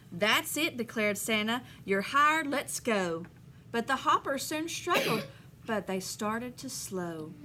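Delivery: lively storybook read-aloud; reading to children. Acted. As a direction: read the rhyme to the class with bounce. Teacher reads picture book aloud.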